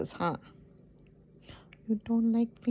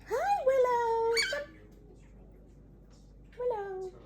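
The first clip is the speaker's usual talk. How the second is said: pet talk. Real, not acted. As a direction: coo sweetly at the pet. Greeting the pet bird.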